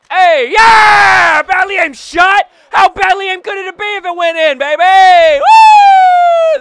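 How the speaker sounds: very high, full-blast excited yelling; goal celebration shout. Real, not acted. Gamer celebrating his goal going in, ecstatic shout. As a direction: shout it wildly, ending in a high whoop.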